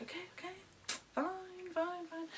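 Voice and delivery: tiny, high, very soft voice; acted grudging surrender. Gives in to being told.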